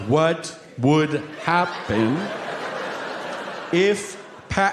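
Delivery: very slow, each word drawn out. Retold or imitated. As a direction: spell it out slowly like to a dummy. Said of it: "he slows it down for me".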